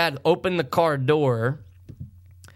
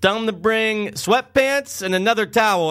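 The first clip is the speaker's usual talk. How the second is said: higher, drawn-out retold pleading; urgent order; 7 semitones higher, slower than usual. Dad sends son for help, urgent order. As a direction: call it out as the desperate dad.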